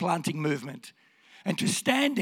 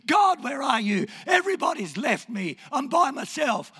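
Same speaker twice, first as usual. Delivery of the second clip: anguished lament; 6 semitones higher than usual. Acted. David telling God everyone has left him, anguished lament. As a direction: cry out as the abandoned psalmist.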